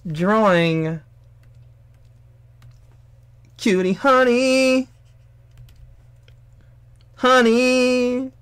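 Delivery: high, loud put-on streamer voice; streamer impression. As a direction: greet the chat like a hyper streamer. Imitating PewDiePie stream greeting.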